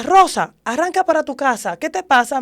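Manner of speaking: imitating an alarmed mother's telling-off; mother scolding. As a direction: scold your daughter loudly over the phone. Acts mother scolding her by phone.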